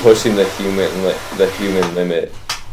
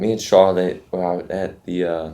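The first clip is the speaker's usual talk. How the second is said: British accent; 2 semitones lower, 7 dB quieter, slower than usual. Shown as a demonstration. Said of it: "You gotta be like".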